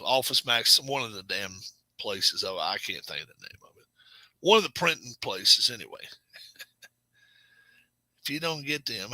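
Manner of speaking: ordinary talk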